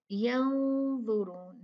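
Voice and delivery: यंजुरून drawn out slowly; slow recitation. Shown as a demonstration. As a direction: stretch it with nasal ghunna.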